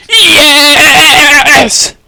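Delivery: high, loud shout of triumph; excited victory shout; triumphant yes. Retold or imitated. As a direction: yell it like you've just won. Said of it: "and she goes".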